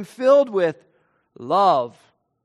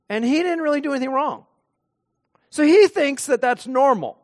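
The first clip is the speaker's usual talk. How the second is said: ordinary talk, nothing marked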